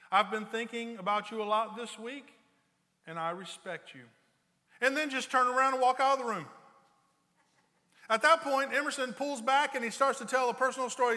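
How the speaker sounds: loud, high, rising preacher's telling of the story; preaching at full force. Acted. Pastor telling wives the respect story, preaching loud. Builds to a loud retold anecdote.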